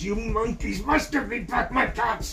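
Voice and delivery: high, loud, furious peddler; storybook outburst at full force; angry shout. A narrator's reading. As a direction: bellow the demand at the monkeys. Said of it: "and he shouted".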